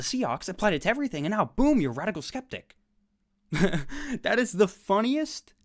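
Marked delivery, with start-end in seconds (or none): 3.5-5.5 real laugh spilling into the words, slow and high. Real, not acted. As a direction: laugh through it in disbelief. Laughing at absurd philosopher reply.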